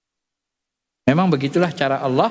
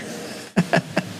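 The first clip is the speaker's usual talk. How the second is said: soft breathy laugh; 5 dB quieter than usual. Real, not acted. Speaker laughs at own story.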